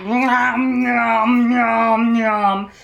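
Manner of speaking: teasing chewing sounds; mock chewing noises. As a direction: imitate him chomping snacks loudly. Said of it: "chew so loudly".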